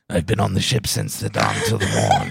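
pirate voice. Retold or imitated. Mock pirate line about a stoner friend. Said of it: "something that only a pirate would say".

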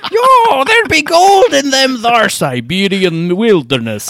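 put-on loud, high, slow old prospector drawl; hollering like a gold-mad old miner; slow, loud hillbilly prospector voice. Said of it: "old prospector slash hillbilly".

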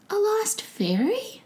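high, eager little goblin voice; helpful goblin. Acted. Goblin answering the prince, high and eager. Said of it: "with a helpful nod".

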